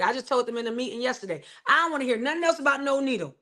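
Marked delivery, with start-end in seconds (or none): 1.7-3.4 sassy, exasperated and quick; fed-up retort. Retold or imitated. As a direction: toss it off like you're done hearing it. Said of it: "That's exactly how I said it".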